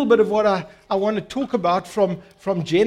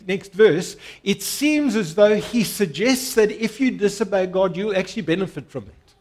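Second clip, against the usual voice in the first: usual speaking voice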